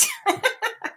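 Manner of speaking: high laugh after an impression; amused, high giggle. Real, not acted. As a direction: break into high-pitched laughter.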